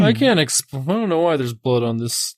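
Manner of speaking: dazed, befuddled artist voice; confused Van Gogh voice. Pretend. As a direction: play a confused painter.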